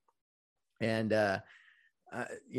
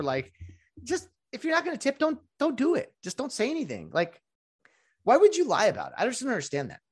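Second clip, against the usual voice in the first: much higher, exasperated pleading; worked-up rant; 7 semitones higher than usual. Real, not acted. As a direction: vent the frustration about empty promises. Ranting about fake tip promises.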